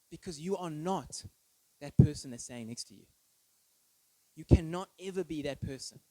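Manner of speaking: ordinary delivery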